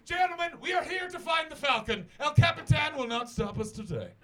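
rally the troops like an Indiana Jones hero; expedition leader. Pretend. Comic telling a fan how he'd rally an expedition, booming. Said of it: "I'm just waiting for you to go".

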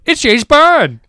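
high-pitched mock kid exclamation; excited kid. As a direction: imitate a kid spotting a movie hero. Imitating excited noisy kids.

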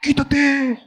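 high snapped demand to move over; impatient driver. Retold or imitated. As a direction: bark at the slow car ahead. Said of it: "Y dices".

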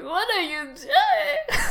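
high squeaky mock panic, laughing through it; a hammed-up, laughing Leif shrieks in disbelief; Leif panicking. Narrator voices a panicked Leif, high and laughing. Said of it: "And Leif is like".

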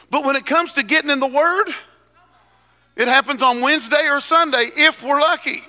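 rising, fast, fiery preaching; preacher voice. Real, not acted. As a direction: preach it with full force. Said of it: "is this my preacher voice?".

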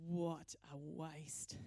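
low, soft and very slow grumble of disgust; mock-sour Judas muttering under his breath. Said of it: "and he just says".

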